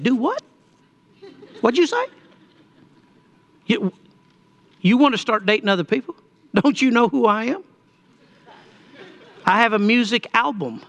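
high-pitched, incredulous questions with soul brother sass. Retold or imitated. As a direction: play up indignant soul brother disbelief. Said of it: "little soul brother in me".

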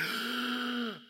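a sharp high gasp of astonishment; startled awestruck gasp; awed gasp. Retold or imitated. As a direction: gasp as if seeing the risen Lord. Thomas sees Jesus.

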